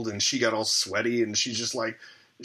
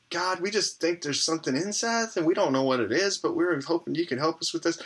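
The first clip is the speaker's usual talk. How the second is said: higher-pitched imitation of an awkward evangelical prayer; clunky evangelical prayer; 8 semitones higher than usual. Wife praying over her husband, imitated as clunky evangelical prayer. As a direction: recite a clumsy prayer, pitched up. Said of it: "this kinda clunky evangelical".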